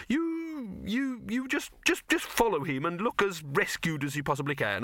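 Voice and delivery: bustle and dither like an anxious little director; fussy flustered director. Acted. Silverfish instructing Victor and the actress, high-pitched and flustered.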